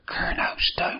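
whispered prompt, voiceless and hushed; stage whisper, feeding a forgotten name. Pretend. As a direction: whisper the answer to your co-host. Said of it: "I'll whisper it".

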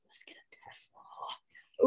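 hushed conspiratorial whisper. Pretend. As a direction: whisper it like a secret plan. Whispered scheming suggestion.

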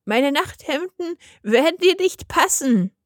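put-on high-pitched old lady voice. A narrator's reading. Grandmother lets the wolf down, in a high old-lady voice. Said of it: "merkte die alte Dame an".

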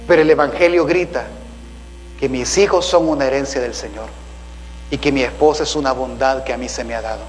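usual speaking voice

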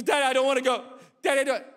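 put-on a touch of little girl's whine; whiny little girl. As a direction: play a scared small daughter saying no. Hope refusing again.

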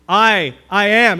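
"I, I am" loud and slow, leaning on each word; emphatic I AM. Shown as a demonstration. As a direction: hammer out "I, I am" with weight. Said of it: "it is an emphatic".

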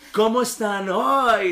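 imitating a religion teacher who wants to seem cool; trying-too-hard teacher. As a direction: greet the children like a teacher forcing the fun. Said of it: "un profesor que quiere ser buena onda".

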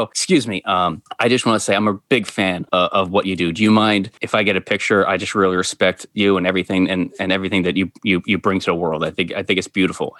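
low, hesitant, humble request; deferential fan. Retold or imitated. Asking a supposed celebrity for a picture, deferential fan. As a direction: speak low and respectfully, as if to an idol.

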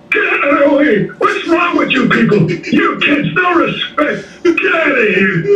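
put-on high, cranky old man shouting. Shown as a demonstration. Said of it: "I really miss not having the old man voice".

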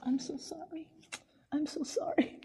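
tiny, high, near-whispered apology; sheepish apology. Retold or imitated. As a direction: imitate an embarrassed patient apologizing very softly. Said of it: "she sheepishly apologized to every doctor and nurse".

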